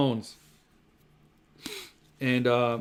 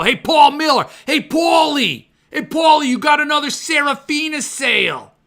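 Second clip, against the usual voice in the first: much higher, loud shouting greeting; calling Paul Miller on a landline, shouting; mock phone call; 10 semitones higher, 7 dB louder than usual. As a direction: hail your buddy over the line.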